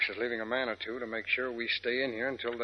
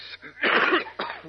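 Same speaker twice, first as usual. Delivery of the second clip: harsh smoke cough; 5 dB louder than usual. Acted. Smoke filling the corridor.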